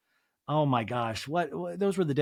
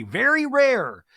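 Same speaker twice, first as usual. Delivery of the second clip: high, slow, loud insistence; a slow, high very rare; emphatic; 9 semitones higher, 6 dB louder, slower than usual. Real, not acted. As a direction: drag it out like gospel. Insisting on the right way.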